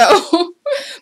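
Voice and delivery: short bright burst of laughter. Real, not acted. Laughs at her sewing trouble.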